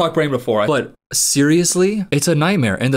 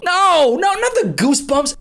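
a squeal of horror at goosebumps; high panicked protest; 11 semitones higher than usual. Real, not acted. Reacting to her own goosebumps, a high panicked no.

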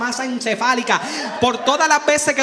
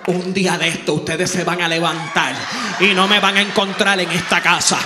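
lower, grave motherly threat; ominous mom; 6 semitones lower, 4 dB louder than usual. Retold or imitated. Mother threatening to leave, low and grave. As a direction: say it like a mother warning she'll vanish. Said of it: "mi mamá me miró a los ojos a mí y me dijo".

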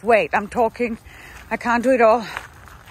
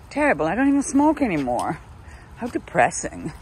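normal talking voice

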